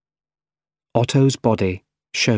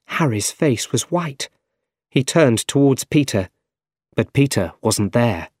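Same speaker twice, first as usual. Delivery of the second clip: ordinary delivery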